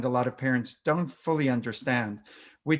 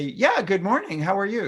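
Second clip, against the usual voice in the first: breezy greeting; 6 semitones higher than usual. Shown as a demonstration. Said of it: "You don't then say".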